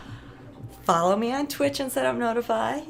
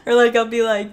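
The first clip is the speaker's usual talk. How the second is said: usual speaking voice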